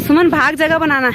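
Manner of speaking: urgent calling out. Real, not acted. Woman left behind urging Suman to run for a spot, calling out. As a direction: call out loudly to hurry someone ahead. Urging companion in race for spots.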